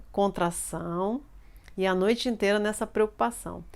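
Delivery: ordinary talk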